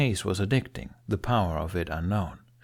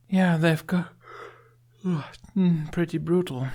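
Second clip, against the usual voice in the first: put-on higher girlish voice for Astrid; young woman voice; 8 semitones higher, slower than usual. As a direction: lift the voice into Astrid's register. Astrid agrees about the raids.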